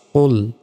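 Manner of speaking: "কুল" said as a heavy "qol" from the back of the throat; full-mouthed qaf. Shown as a demonstration. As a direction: round and thicken the vowel of "কুল" toward "qol". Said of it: "কুল মোটাভাবে উচ্চারণ হবে".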